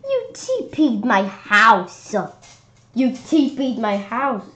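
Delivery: slow, loud, pointed accusation; accusing. A narrator's reading. Kid accusing A J of toilet-papering his house. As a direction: hammer each word at him.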